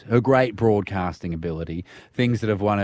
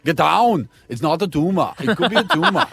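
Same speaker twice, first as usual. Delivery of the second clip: higher, hammed-up Arnie accent; 6 semitones higher than usual. Co-host demonstrating Arnie lines to colleague, hammed-up accent. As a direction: exaggerate Arnie's movie-line accent. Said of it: "Can you speak like Arnie?".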